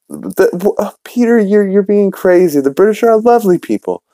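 high falsetto wife impression; imitating a wife. Wife reassuring her husband about the British, high mock-feminine protest.

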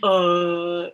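drawn-out mock storytelling with a long "uh"; ponderous, stretched-out parody; Jughead impression. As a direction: imitate his halting, self-important account. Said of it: "It's a really good Jughead impression".

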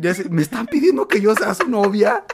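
high, loud mock disbelief; bewildered teacher. Teacher asked to be the girlfriend, high loud disbelief.

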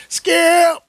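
loud high call; shouting Skip. Pretend. Shannon Sharpe bit calling his co-host, shouting. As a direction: holler it across the studio.

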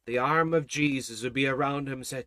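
ordinary delivery

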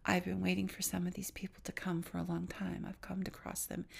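the angel's words in a soft whisper; a calm, hushed otherworldly whisper. Retold or imitated. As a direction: whisper it gently as the angel of death. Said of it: "And he said".

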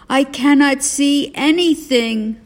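dazzled complaint. A narrator's reading.

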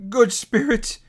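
high, pleading address; imploring. A narrator's reading. Scrooge fallen before the spirit, imploring. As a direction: call on the spirit for mercy. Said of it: "he pursued".

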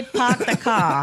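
very slow Boston accent, "park the car" as "pahk the cah". Shown as a demonstration. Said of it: "you have to say".

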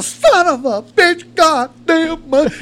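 high, loud, drawn-out cursing; slow, anguished mock tirade; cussing, raising hell. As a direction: imitate a buddy blowing up. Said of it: "he goes cussing, raising hell".

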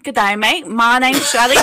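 loud, hammed-up Australian accent; comic Australian catchphrase accent. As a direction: greet like a broad Australian, loudly. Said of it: "do a pretty good Australian accent".

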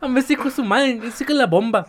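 high, loud sing-song Yucatecan voice; Yucatecan accent. Retold or imitated. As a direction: ham up a Yucatecan, laughing. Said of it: "te sale el acento yucateco". (acento yucateco cantadito y riendo)

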